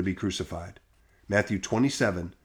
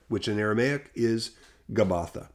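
ordinary delivery, nothing marked

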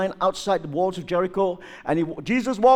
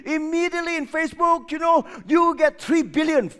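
excited shout; 9 semitones higher than usual. Real, not acted. Preacher imagining Jesus going viral, excited shout. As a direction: sell the billion likes with glee.

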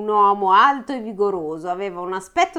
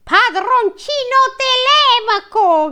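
very high, slow, hammed-up joyful call; joyful cry; 7 dB louder, slower than usual. A narrator's reading. Eumeo greeting Telemaco, high slow joyful cry. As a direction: cry out the young master's name with glee. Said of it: "gridò gioiosamente Eumeo".

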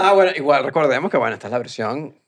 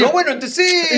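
high-pitched flustered climbdown; confidence collapsing; 4 dB louder than usual. Acted. Acting out the suspect's lost confidence, flustered. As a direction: back down in a squeaky, laughing panic. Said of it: "Cambió la seguridad".

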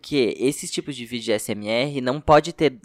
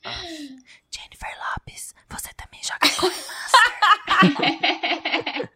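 high unvoiced whisper; breathy ad line whispered close to the mic; whispered asmr ad; slower than usual. ASMR version of the ad.

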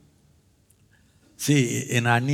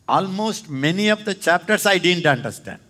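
usual speaking voice

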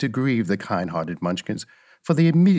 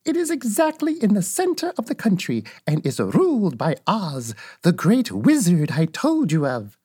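put-on high-pitched old witch voice; explaining old witch; 6 semitones higher than usual. Old witch telling Dorothy about Oz, high explaining witch voice.

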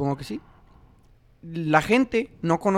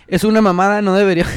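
reads it out loud and firm; hammed-up outrage at a viewer comment; loud reading of comment; 8 dB louder, faster than usual. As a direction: comment read out with louder outrage. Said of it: "comenta Manolo".

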